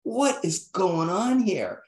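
a hammed-up replay of being scared out of his wits; freaking out. Recalling the ceremony for the host, mock panic. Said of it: "I'm freaking out".

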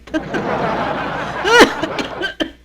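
big rising burst of laughter; loud high laugh. Real, not acted. Vlogger cracking up at her own joke, loud high laugh. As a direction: let out a loud, high cackle.

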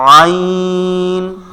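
very slow throaty ʿayn; letter demo. Shown as a demonstration. Teacher draws out আইন very slowly for students. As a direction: stretch আইন from the middle of the throat. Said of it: "কণ্ঠনালীর ঠিক মধ্যখান থেকে".

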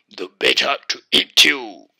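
loud, forceful wolf pounce; menacing wolf. A narrator's reading. Wolf about to devour the girl, loud menace. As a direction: burst out loud as the wolf attacks.

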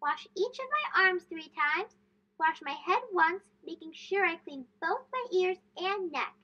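put-on a boy happily reciting his lessons; reciting the steps. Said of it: "continued through the steps".

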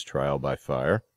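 ordinary talk

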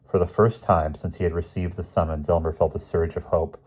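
plain reading voice

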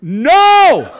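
high, loud, drawn-out "no"; yelled refusal. Retold or imitated. Husband refusing to blow the whistle, loud high yell. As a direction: yelp a panicked refusal.

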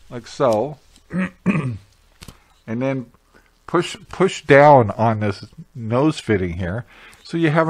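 muffled behind mask fabric; speaking into cloth respirator; through a face mask. Real, not acted.

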